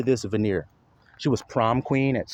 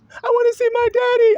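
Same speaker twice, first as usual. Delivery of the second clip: put-on much higher, louder whining little child; crying child voice; 5 dB louder than usual. Mocking a small child crying for his absent daddy, high and wailing. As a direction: wail like a child begging for daddy. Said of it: "Does that sound tender and delicate?".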